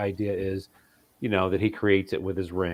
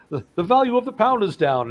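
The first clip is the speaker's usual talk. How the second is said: Spider-Man; 10 semitones higher, 4 dB louder than usual. Acted. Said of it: "have Spider-Man reading a paper".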